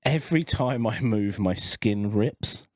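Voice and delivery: slow, weighty title reading; slow dramatic title read. Retold or imitated. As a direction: read it like a grim headline. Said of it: "and the title was".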